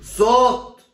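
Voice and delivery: loud, high startled yell; alarmed shout. Acted. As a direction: bark it in alarm. Proctor hears cheating.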